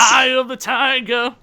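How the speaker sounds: high, loud announcer shout. Pretend. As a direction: belt the title out. Shouted movie title joke.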